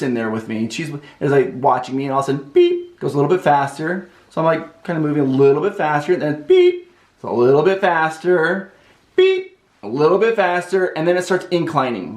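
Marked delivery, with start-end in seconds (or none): 2.5-3.0 short electronic-style beep; imitated machine beep. Treadmill signalling a speed-up to the runner, imitated beep. 6.4-6.9 high, sustained beep; imitated machine beep. Treadmill speeding up under the runner, high imitated beep. 9.2-9.5 vocal beep like a treadmill; imitated machine beep. Treadmill getting a little bit faster, imitated beep.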